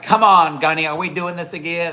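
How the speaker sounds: high, loud exasperated cop; imitated cop. Cop pleading with a repeat troublemaker, imitated exasperation.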